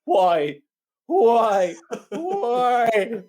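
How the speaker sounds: high, loud, hammed-up wail; mock dying cry. Imitating the dying young patient, hammed-up wailing. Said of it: "young fella sits up and goes".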